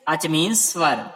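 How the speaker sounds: normal talking voice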